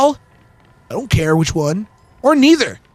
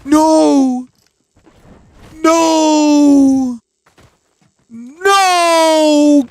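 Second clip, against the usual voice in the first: panicked screaming; 10 semitones higher, much slower than usual. Acted. Streamer mock-screaming in panic at a scare. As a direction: shriek in mock panic, begging.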